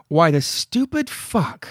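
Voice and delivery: slow, drawn-out curse in an odd voice; Wilma's outburst. Retold or imitated. Reading Wilma's last line with the curse word swapped in, slow and odd. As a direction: spit out the swear slowly, like a comic punchline. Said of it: "So I'll use".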